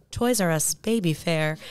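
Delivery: put-on radio ad voiceover; Kimberly. Shown as a demonstration. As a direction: sell it in a fake announcer voice. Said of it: "my Kimberly voiceover".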